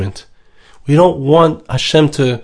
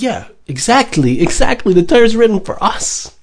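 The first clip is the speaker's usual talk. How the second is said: Moshe agreeing eagerly, high; a high, eager yes, exactly; Moshe answering; 7 semitones higher than usual. Acted. Moshe Rabbeinu answers the angels, high and eager. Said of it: "And Moshe Rabbeinu says".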